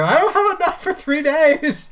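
high, loud fretting voice; mock worried wife. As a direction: fret like a panicked wife. Imitating wife's worry, mock.

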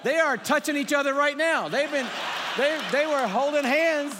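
big excited preacher shout. Real, not acted. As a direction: shout it out and hype the room. Hyping the Puerto Ricans in crowd.

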